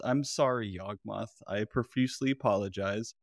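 ordinary talk, nothing marked

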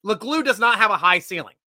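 ordinary delivery

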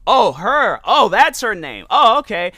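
like a penny dropping, loud; sudden realization. Retold or imitated. To coworkers who named the manager, loud surprised realization. Finally learning the manager's name.

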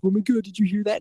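high, startled mock panic from scared strangers; spooked strangers. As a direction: play a spooked stranger in a graveyard, lightly scared. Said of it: "and they're like".

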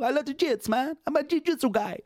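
high Brazilian accent impression. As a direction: voice a Brazilian jiu-jitsu guy. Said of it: "You're doing impressions again".

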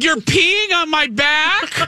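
high, slow disbelieving question; shocked. Retold or imitated. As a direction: squeak it in disbelief. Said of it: "I'm like".

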